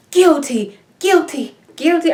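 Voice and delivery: high-pitched mock verdict, each one separate; chorus of clones. All the clones give their verdict, high mock chant. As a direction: call it out like a jeering crowd of jurors.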